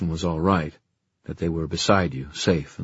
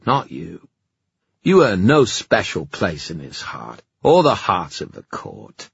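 haughty, contemptuous knight; arrogant fairy knight; 4 dB louder than usual. Acted. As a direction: look down and sneer. Erec voiced by narrator.

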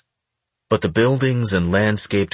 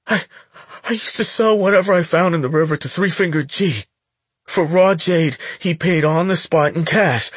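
put-on high, nervous young boy; a scared teen stammering to a gangster; frightened boy voice; 10 semitones higher, 3 dB louder than usual. Scared boy tells Hilo.

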